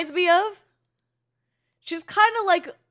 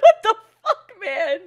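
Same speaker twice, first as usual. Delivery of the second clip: high, loud laughing replay of the shock; retold shocked yell; 5 semitones higher, 8 dB louder than usual. Retelling her reaction to the horse scene, laughing yell. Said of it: "I'm like".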